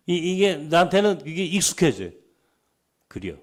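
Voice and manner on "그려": understated Chungcheong accent; Chungcheong dialect. Shown as a demonstration. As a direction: drop in a homely Chungcheong word. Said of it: "이게 충청도 사투리거든요". (충청도 사투리로)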